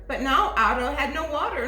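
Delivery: plain reading voice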